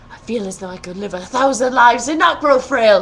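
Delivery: prince's thoughts. A narrator's reading. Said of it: "he thinks to himself".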